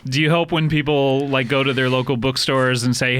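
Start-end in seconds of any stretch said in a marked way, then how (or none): none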